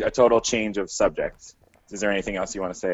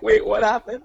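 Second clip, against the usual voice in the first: high, puzzled mock question; confused old man; 11 semitones higher, 3 dB louder than usual. Co-host voicing the baffled driver, high confused question. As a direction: play the lost old driver.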